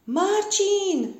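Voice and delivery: high-pitched, drawn-out call. A narrator's reading. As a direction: hail someone from afar, without much volume. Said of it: "krzyknęła żabka". (wysokie wołanie)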